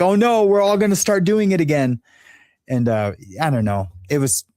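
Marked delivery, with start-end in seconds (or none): 0.0-2.0 high-pitched mock dismay; retold sad dismay. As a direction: imitate her wailing oh no, much higher. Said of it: "made her so sad".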